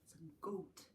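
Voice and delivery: ordinary delivery, nothing marked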